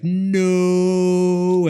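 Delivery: loud, high, drawn-out no; mock anguished no. Retelling the chainsaw man's dramatic slow-mo cry, loud drawn-out no. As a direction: wail it like a slow-mo movie scene. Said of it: "chainsaw man's like".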